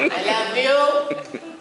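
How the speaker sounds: drawn-out, high, laughing "I love you"; slow happy drunk. Retold or imitated. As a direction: stretch it out like a sappy drunk. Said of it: "I'm one of them happy drunks".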